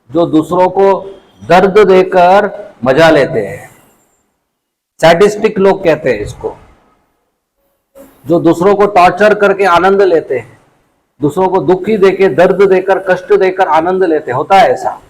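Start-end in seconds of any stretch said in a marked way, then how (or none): none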